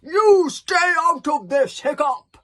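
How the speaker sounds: high, loud, slow roar; roaring father. Acted. Stoic silencing his son Hiccup with a roar. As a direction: roar him down. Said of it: "roared Stoic the Vast".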